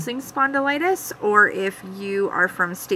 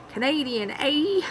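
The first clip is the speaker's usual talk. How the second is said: mock Canadian; 6 semitones higher, slower than usual. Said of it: "I guess, us Canadians".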